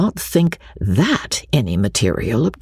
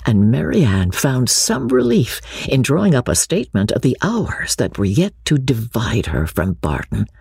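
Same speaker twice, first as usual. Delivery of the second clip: usual speaking voice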